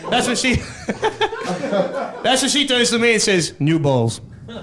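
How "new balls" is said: lightly voiced umpire call, lower; tennis umpire call. Wife calling new balls like a tennis umpire, lightly voiced. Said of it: "that tennis umpire voice".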